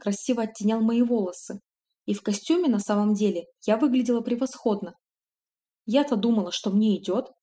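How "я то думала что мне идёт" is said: usual speaking voice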